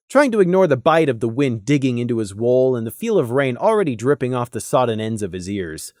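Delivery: ordinary delivery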